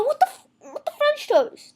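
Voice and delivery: high, loud comic outrage; mock disbelief. Viewer reacting to bad acting, loud mock disbelief. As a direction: burst out, cutting off the swear.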